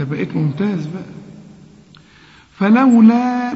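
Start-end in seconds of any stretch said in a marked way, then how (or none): 2.6-3.5 four-count elongation on فلولا; slow Quranic recitation of فلولا; lengthened madd. Shown as a demonstration. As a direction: stretch the vowel in فلولا to four beats. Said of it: "مد أربع حركات".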